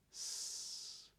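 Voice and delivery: "s" hissed as pure air, no voice; voiceless S. Shown as a demonstration. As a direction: hiss a voiceless "s". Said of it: "An S is voiceless".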